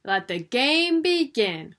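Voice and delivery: slow, loud commanding queen; Queen of Hearts ordering the croquet game to start; royal order. A narrator's reading. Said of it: "ordered the Queen".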